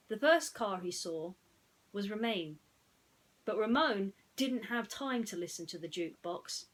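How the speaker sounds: ordinary delivery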